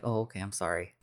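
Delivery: a small deflated sorry; meek apology. Retold or imitated. Uncle Ben backs down.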